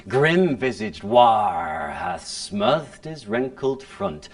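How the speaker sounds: theatrical verse speaking with bitter edge; dramatic classical verse delivery; Shakespeare recitation. Acted. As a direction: speak Richard's soliloquy as on stage. Recites Richard III opening.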